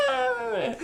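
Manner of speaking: mock startled shriek. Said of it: "And she's like".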